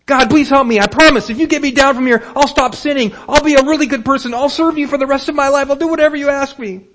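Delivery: high, loud, rushed promises to God; frantic pleading. Acted. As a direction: beg fast, tumbling over the promises. Jack bargaining while hanging.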